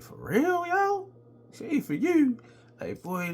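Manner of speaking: high, loud disbelief; "For real?" in shocked amazement; incredulous. Retold or imitated. Friend disbelieving friend turns girl down.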